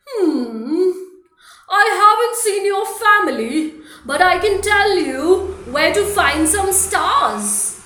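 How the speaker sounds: loud, bouncy otter voice, slowing at the end; cheerful Mister Otter. Acted. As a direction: play the otter big and jolly. Mister Otter answers Gum Gum.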